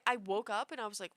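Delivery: ordinary talk, nothing marked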